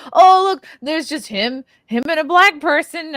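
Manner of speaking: high, loud mocking reaction; mock left-wing viewer. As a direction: pipe up loudly as a scoffing onlooker. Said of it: "a left-wing person watching this going".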